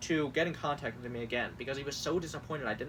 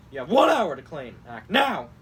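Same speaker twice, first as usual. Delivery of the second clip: loud bursts on "one hour" and "now"; mock all-caps shout; 10 dB louder than usual. Host reads photo offer text, shouting the deadline. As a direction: bark the deadline like a pushy ad. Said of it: "certain things that, like, is being all caps".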